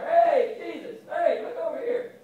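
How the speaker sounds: high, loud desperate shout. Retold or imitated. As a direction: yell over the crowd to be heard. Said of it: "And he gets louder".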